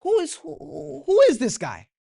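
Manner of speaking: high, incredulous voice; David's outrage. Retold or imitated. Preacher voicing David, scoffing at Goliath. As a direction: act out David's disbelief. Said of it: "So David's finally speaking, like".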